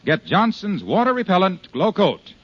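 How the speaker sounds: ordinary talk, nothing marked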